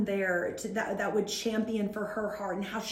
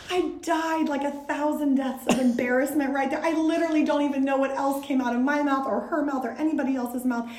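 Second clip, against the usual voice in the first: usual speaking voice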